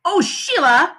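put-on high, loud annoyed boy's shout; exasperated shout. A narrator's reading. Peter fed up with Sheila on his rocks, exasperated shout. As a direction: shout her name in exasperation. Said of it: "I shouted".

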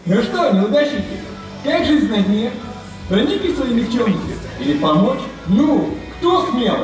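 mocking taunt. Bully taunting the losers.

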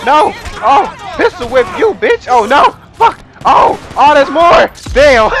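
loud, high bursts of alarm; frantic yelps in the middle of a gunfight; panicked shouting. Real, not acted. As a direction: shout in short, startled bursts. Gunfight erupts in game.

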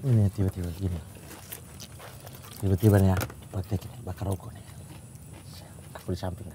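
quiet, mumbled imitation of a father talking to himself; hushed, half-swallowed demo of the dad's mumbling; mimicking dad's muttering. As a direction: drop way down and mumble like the dad. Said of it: "dulu tuh ngomongnya gimana?".

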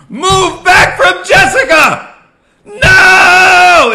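high, loud, slowed-down screaming; re-enacted scream. Son screaming at his father to move away, loud mock scream. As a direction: scream it in mock slow motion. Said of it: "I'm screaming".

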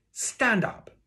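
high, loud parade-ground command; shouted order. A narrator's reading. Teacher ordering pupil to his feet, shouted. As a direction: bellow the order. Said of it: "he shouted".

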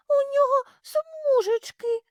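high shrill squeal. Acted. Little hare squeals to its mother about stripes. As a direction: squeal to mom in alarm.

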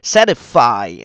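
slow Satisfy, SA punched loud; stress on first syllable. Shown as a demonstration. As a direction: hit SA hard, draw out Satisfy. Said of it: "âm một". (nhấn mạnh âm một Satisfy, đọc chậm)

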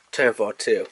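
ordinary delivery

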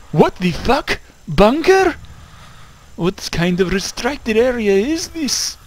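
high loud baffled exclaim; surprised. Real, not acted. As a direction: blurt out in disbelief. Startled at bunker.

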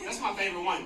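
normal talking voice

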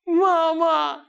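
high, loud call for mom; imitated child's cry. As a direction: call out like the little daughter in trouble. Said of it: "And what was she saying?".

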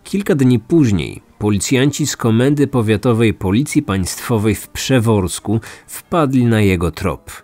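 ordinary talk, nothing marked

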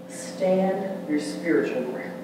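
ordinary talk

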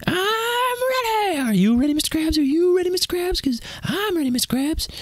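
squeaky, very high cartoon eagerness; cartoon sponge voice. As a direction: chirp it way up like an overexcited sea sponge. Cartoon sponge greeting Mister Krabs.

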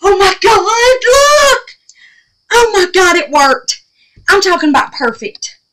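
high, loud thrilled cries; gasping with delight at the result; real excitement. Real, not acted. Crafter sees the powder worked, thrilled and loud. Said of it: "I get excited when something works".

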